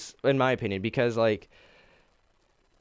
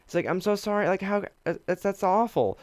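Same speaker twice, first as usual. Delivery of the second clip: mock sympathy; 9 semitones higher than usual. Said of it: "and the world was like".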